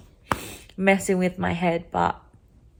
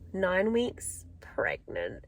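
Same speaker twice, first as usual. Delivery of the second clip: whispered, much softer, slowed down; secret whisper; 9 dB quieter, much slower than usual. Real, not acted. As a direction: breathe nine weeks pregnant like a secret. Revealing a secret pregnancy.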